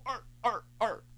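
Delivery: high barking seal impression; mocking his own voice as a barking seal. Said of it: "my little seal voice go".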